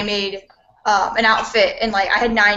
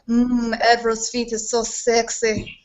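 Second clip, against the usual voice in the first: slow, hammed-up Russian accent; slower than usual. Cosplayer rereads the viewer comment, slow hammed-up Russian accent. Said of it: "I wonder if I can do it in Russia".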